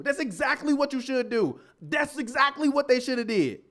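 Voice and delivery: normal talking voice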